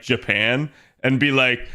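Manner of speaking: ordinary delivery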